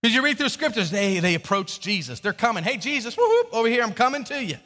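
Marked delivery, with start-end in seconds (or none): 2.6-4.6 calling out. Acted. Crowd member hails Jesus from afar, high excited call. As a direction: call out over the crowd, "whoop, whoop".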